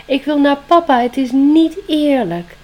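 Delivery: high, loud wail, slowing at the end; desperate cry. Acted. Spring cries out inside, longing for papa, loud and high. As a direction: cry out against the unfairness. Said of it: "schreeuwde het van binnen".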